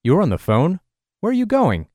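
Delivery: an indignant man raising his voice at Amy. Retold or imitated. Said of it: "Raising his voice and using a highly indignant tone".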